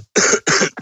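dry cough into the mic; coughing fit. Real, not acted. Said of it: "I'm coughing in, in your ear here".

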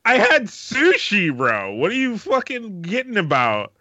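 high indignant sushi protest. Real, not acted. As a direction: defend the sushi order, indignant. Defensive outburst to friend.

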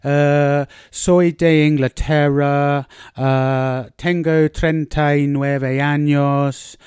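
put-on slow, careful Spanish in a heavy English accent; plodding textbook Spanish with a thick English accent; mock English accent in Spanish. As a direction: sound like a stiff English learner of Spanish. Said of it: "basically speak like".

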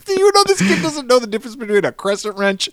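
high, loud incredulous rant; mock outraged dad; exasperated dad. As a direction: complain like a fed-up father. Dad ranting about son.

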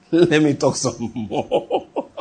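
slow, drawn out through a chuckle; chuckle, then stretch the words right out; laughing drawl. Real, not acted. Preacher teasing congregation about his digression, laughing and drawn out.

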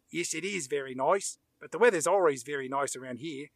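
lightly imitated Australian woman; Australian accent. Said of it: "the woman says in an Australian accent".

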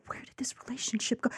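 hushed, nagging question; whispered inner voice. Retold or imitated. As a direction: whisper it like a thought that won't settle.

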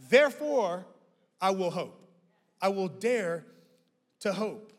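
loud, high Therefore, then a firm I will hope; preached declaration. Acted. To the church, daring to hope, declared loud. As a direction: declare it boldly. Climax of sermon point.

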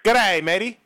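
loud, high Australian greeting; Australian accent. Shown as a demonstration. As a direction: call it out in a put-on Aussie accent. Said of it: "can you do an Australian accent".

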